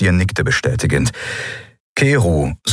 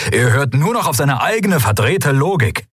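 higher, emphatic exasperation; agitated outburst; 8 semitones higher than usual. A narrator's reading. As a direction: let the frustration push the voice up. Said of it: "Telios wurde lauter". (aufgebracht und nachdrücklich)

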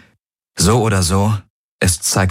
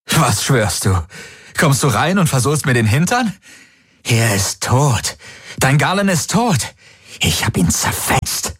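taunting, pitch leaping high then dropping to a hiss; hysterical, hunted; 8 semitones higher than usual. A narrator's reading. Possessor mocking her that her friend is dead, hysterical. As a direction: jeer hysterically, swinging between high and breathy. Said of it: "Es klang hysterisch, gehetzt".

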